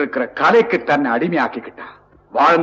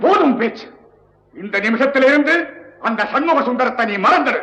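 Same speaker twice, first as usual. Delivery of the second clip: angry command; 7 semitones higher than usual. Acted. King threatens Mohana.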